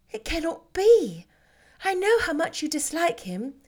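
a gentle elder sister refusing to believe it; Jane, incredulous. Acted. Jane still disbelieving.

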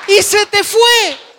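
high, loud, slow dramatic wail; mock lament. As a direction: wail it like the chance is gone forever. Lost chance, mock lament. (lamento exagerado, agudo, fuerte y lento)

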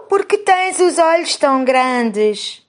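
put-on high, slow little girl voice; a small girl asking, pitched high. A narrator's reading. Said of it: "A menina pergunta à avó".

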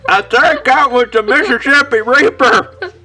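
strained and breathless, mouth burning; forcing words past a burning mouth; gasping from the heat. Real, not acted. Just ate hottest pepper.

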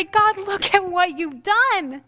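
hushed, grateful marvel; grateful to God. Pretend. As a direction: let the thanks unfold slowly. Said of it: "Or I could say".

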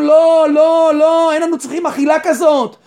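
imitating an impassioned protest; acted refusal. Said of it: "והם משיבים".

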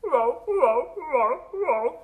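repeated wailing animal bellow; walrus bellowing. Acted. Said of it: "I hear a walrus bellowing".

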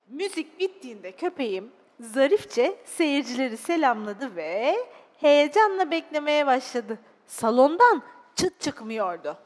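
expressive, dramatised storytelling; children's story narration. Acted. Narrator telling children of a sad little dog, expressive storytelling. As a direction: read it to small children with feeling.

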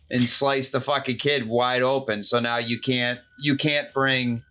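ordinary delivery

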